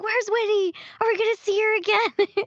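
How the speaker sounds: high-pitched eager fan questions; excited retold questioning. Voice actress recalling her reaction to the game, excited. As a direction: blurt it out high, like an excited player. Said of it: "I screamed a lot".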